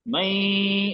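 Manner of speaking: "मैं" drawn out through the nose; long nasal hold. Shown as a demonstration. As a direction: hold the end of "मैं" as a nasal hum for a few beats. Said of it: "इसको दो तीन हरकत के लंबा खींचेंगे".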